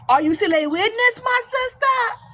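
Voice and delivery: imitated African accent climbing high on the question; African brother's accent. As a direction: mimic a shocked African brother. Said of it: "this brother from Africa".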